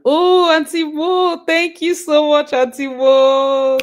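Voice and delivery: loud, high, drawn-out cheer; elated thanks. Real, not acted. Streamer thanking Auntie Mo for her support, elated cheer. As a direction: whoop it out, stretching the vowels.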